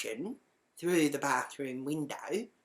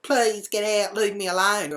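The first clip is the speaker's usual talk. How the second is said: raised, louder mock-upset begging; imitated tearful plea; 5 semitones higher, 6 dB louder than usual. Imitating the crying housemate in a towel, mock tearful plea. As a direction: plead like someone upset, lightly acted. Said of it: "and he's crying".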